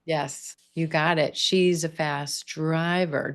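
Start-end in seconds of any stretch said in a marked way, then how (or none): 1.4-3.3 She's a fast driver, driver takes the primary stress; slow, hit driver. Shown as a demonstration. Teacher confirming the student, stress on driver. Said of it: "Driver's gonna get that primary stress".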